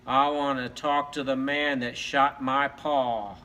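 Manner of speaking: slow, low Western showdown voice; drawn-out low gunslinger delivery; cowboy dog. Acted. Wounded dog returns for the shooter, slow cowboy drawl.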